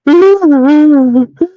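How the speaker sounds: loud, high drawn-out mock sob; mimicked wailing cry. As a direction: imitate someone wailing in the distance. Imitating the crying heard again.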